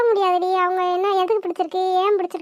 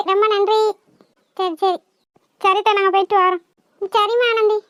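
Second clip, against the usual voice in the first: ordinary talk, nothing marked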